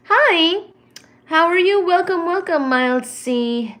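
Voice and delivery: high, loud gushing hello; squealing welcome to new viewers; excited greeting. Real, not acted. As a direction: greet them with delight.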